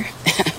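a quiet breathy chuckle; little dismissive laugh; soft short laugh. Real, not acted. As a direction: laugh it off softly. Laughs after I don't care.

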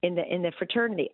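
ordinary talk

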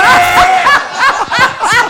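high, loud burst of laughter; big laugh. Real, not acted. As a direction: crack up at the memory. Laughing at the heckle.

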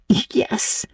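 whispered, high and hushed; whispered yes. A narrator's reading. Diana answers Anne at the door, whispered. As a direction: breathe it so the aunt won't hear. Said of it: "answered Diana".

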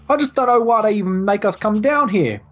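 grumbling bored guard. Acted. Guard complaining to a mate about pointless duty, grumbling.

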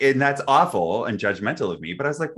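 ordinary talk, nothing marked

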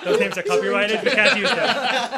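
laughing. Real, not acted. Joking through laughter.

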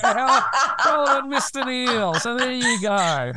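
high, slow teasing sing-song; cheeky teasing. Acted. Host teases [name] on his birthday, laughing and high.

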